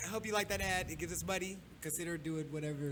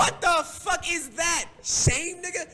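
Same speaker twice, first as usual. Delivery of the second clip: outraged disbelief; 12 semitones higher, 13 dB louder, much slower than usual. Real, not acted. Outraged at the shame chant.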